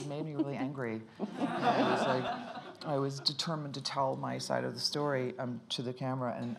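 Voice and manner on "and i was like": high, slow, stretched through a laugh; laughing words. Real, not acted. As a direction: let the laugh carry the words. Laughing mid-sentence.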